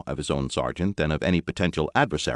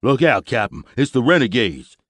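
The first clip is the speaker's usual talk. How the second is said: higher, louder warning cry; alarmed shout; 7 semitones higher, 7 dB louder than usual. A narrator's reading. Sergeant warning his captain about renegades, alarmed shout. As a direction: yell a sudden warning. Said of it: "his voice went up an octave".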